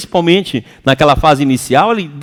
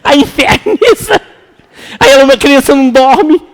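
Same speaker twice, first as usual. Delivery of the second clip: laughing; 7 semitones higher, 5 dB louder than usual. Real, not acted. Laughing about the chaos.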